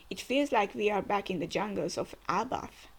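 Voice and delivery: ordinary talk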